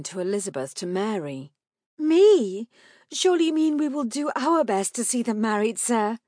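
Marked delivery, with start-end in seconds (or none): 2.0-6.2 high, loud, startled protest; indignant wife. A narrator's reading. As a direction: push back in a raised, disbelieving voice. Mother protests in disbelief.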